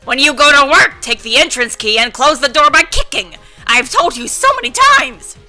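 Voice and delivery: loud, angry scolding grandma; rowdy grandma. Acted. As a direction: blow up at the forgetful grandson. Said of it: "rowdy grandma voices".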